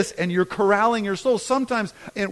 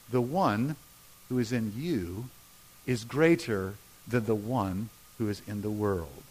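low, soft, with pauses between phrases; deep, subdued scripture reading; hushed verse reading; 9 semitones lower, 6 dB quieter than usual. Shown as a demonstration. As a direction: quote it quietly, phrase by phrase. First quiet reading of verse.

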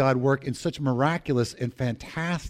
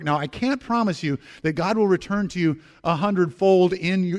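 ordinary delivery

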